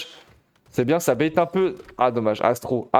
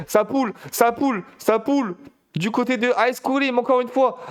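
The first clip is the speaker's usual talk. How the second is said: voix haute et chantante, emballée; excitation de commentateur; 9 semitones higher than usual. Real, not acted. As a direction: monte d'un cran, porté par l'action. Caster hyped by aggressive play. (le caster s'enflamme devant l'attaque agressive)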